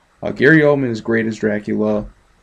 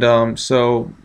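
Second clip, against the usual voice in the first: ordinary talk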